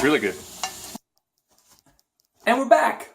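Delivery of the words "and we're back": excited announcement. Real, not acted. Returning after an edit cut.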